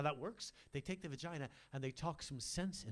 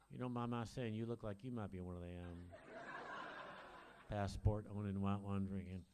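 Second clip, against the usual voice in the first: bigot voice; 6 semitones lower, 5 dB quieter than usual. Acted. Comic plays homophobic bigot character.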